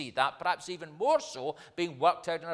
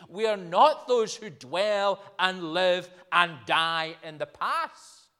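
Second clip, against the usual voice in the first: raised, slow pulpit proclamation; fervent preaching; 5 semitones higher, 9 dB louder, slower than usual. Acted. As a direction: proclaim it slowly, stretching the key words.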